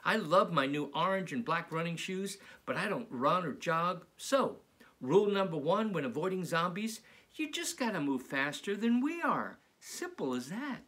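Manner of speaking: bright, lively read-aloud voice; animated children's story reading; storybook narration. A narrator's reading. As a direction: read it to a class of kids. Reads picture book aloud.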